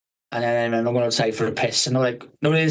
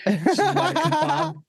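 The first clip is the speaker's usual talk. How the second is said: words squeezed out through high laughter; laughing; much slower than usual. Real, not acted. Laughing at her own drunken kebab craving. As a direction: laugh through the line, slowly.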